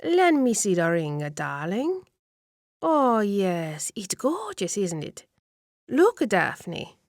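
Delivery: lightly voiced elderly aunt admiring a ring; gushing old lady. Nona admires the ring to Daphne, lightly voiced gushing old lady. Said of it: "Nona reaches out to the old lady".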